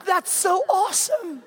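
high, slow, laughing over-sweet gush; dad pretending to be thrilled; mock forced cheer. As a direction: ham up fake enthusiasm. Dad's mock forced enthusiasm.

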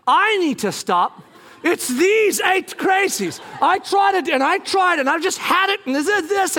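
high, defensive rant; indignant outburst. Retold or imitated. Husband defending himself to wife, indignant outburst. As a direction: protest as if wrongly blamed.

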